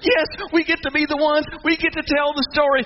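high, elated outburst; thrilled. Acted. Two angels sent to the tomb, thrilled. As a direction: burst out with the honor of being picked.

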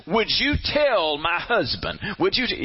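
slow, indignant mock appeal; mad wife. Wife too mad to address her husband, indignant. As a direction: stretch out the indignant request through a third person. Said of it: "She is so mad she can't talk".

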